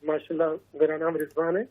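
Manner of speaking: soft, low-pitched phone-in caller; faint voice. Real, not acted. Caller introducing himself to the show's hosts, faint voice. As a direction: speak too quietly to be heard well. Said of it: "آواز کم آ رہی ہے بہت".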